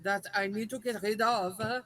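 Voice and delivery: put-on slow, halting French-accented English. Customer offering money and mushrooms to a ticket tout, French accent. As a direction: grope for the English words in a thick French accent. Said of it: "do it in your French accent".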